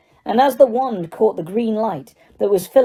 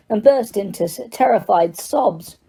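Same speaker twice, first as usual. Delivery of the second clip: plain reading voice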